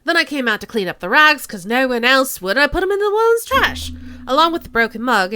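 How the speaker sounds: loud, quick Cockney patter. Acted. Suspect telling officer about the trash, loud Cockney.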